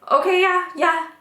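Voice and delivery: high-pitched, stunned little yes; shocked agreement. Retold or imitated. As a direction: retell a dazed, high-voiced reply. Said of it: "I was just very shocked".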